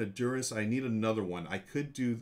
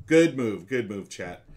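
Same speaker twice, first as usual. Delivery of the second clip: loud, punchy praise; excited praise; 8 dB louder, slower than usual. Real, not acted. Streamer cheering chat's smart move, loud excited praise.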